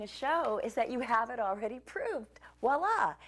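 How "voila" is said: bright, loud, high reveal; triumphant voila. Real, not acted. Cook revealing the finished dough to viewers, triumphant. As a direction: announce it like a magic trick.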